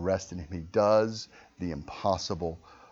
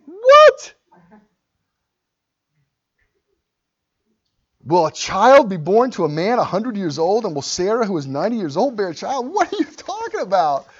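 high, loud disbelieving cry; Abraham can't believe a son is coming; incredulous Abraham; 11 semitones higher, 12 dB louder than usual. Retold or imitated. Preacher voicing Abraham to God, high loud disbelief.

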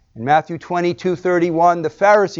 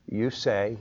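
terse; 5 semitones lower, 10 dB quieter than usual. Acted. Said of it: "his terseness shows indignation".

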